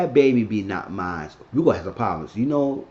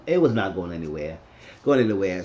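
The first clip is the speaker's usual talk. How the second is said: plain reading voice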